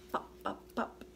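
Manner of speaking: soft popping sounds; cork pops. Pretend. Reading a picture book, softly popping like a cork. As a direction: pop it out like a cork. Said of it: "He can sound like a cork".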